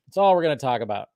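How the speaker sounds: ordinary talk, nothing marked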